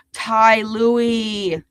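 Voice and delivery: ordinary talk, nothing marked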